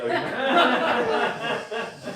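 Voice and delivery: a sudden, high, loud burst of laughter; hearty laugh. Real, not acted. Laughs at Darwin's marriage notes.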